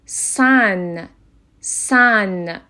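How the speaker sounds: "سن" as sæn, vowel like in cat; open A example. Shown as a demonstration. Teacher giving the second example, "سن" drawn out as sæn. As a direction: stretch "سن" with an open short-a. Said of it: "به صورت ا تلفظ شد".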